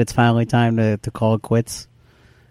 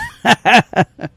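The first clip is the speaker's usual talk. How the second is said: loud high cackling laugh; cackle; 11 semitones higher, 5 dB louder than usual. Real, not acted. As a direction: cackle into the mic. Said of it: "Sorry to cackle into the mic".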